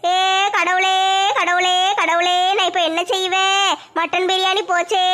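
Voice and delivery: drawn-out, hammed-up wail of regret; wailing lament.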